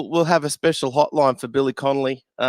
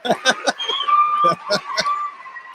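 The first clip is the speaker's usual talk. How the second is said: high breathy giggle; high laugh. Real, not acted. Host giggling at the comments. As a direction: let a high giggle slip out.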